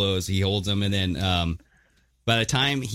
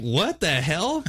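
slow disbelief; 6 semitones higher, much slower than usual. Retold or imitated. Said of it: "And it's like".

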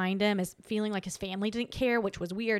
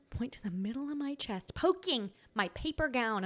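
normal talking voice

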